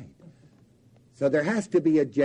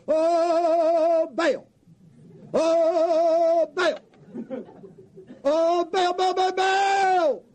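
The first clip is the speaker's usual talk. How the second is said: high wailing cries, slower on the last; mock frantic prayer; 11 semitones higher, much slower than usual. Prophets of Baal crying out to their god, building to a shout.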